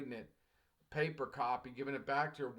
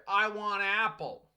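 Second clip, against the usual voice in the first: louder, slow broken-English request; hammed-up learner asking for apple, louder and slow; broken English demo; 6 semitones higher, 10 dB louder, slower than usual. Shown as a demonstration. Teacher acting a learner ordering from a clerk, louder and slow. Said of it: "go to the produce clerk and say".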